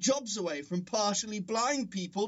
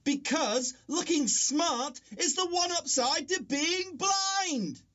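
angry outburst; 6 semitones higher than usual. Acted.

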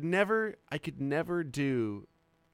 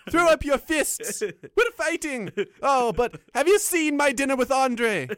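high, loud, drawn-out cartoon leprechaun; hammed-up Irish brawler, pitched way up; 9 semitones higher, 5 dB louder, slower than usual. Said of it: "he turned into a leprechaun".

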